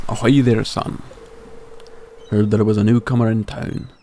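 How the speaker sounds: hearty Scottish-tinged sailor brogue; Willie, Scottish accent. Acted. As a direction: play an old fisherman with a makeshift Scottish accent. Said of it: "doing a Scottish accent for Willie".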